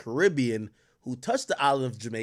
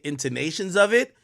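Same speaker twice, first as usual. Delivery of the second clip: usual speaking voice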